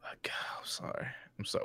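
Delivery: fast mock whisper of "I'm sorry"; whispered apology. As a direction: hush it like an apology to the sponsors.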